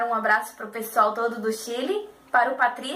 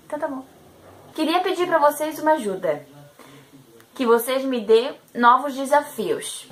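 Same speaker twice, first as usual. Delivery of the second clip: usual speaking voice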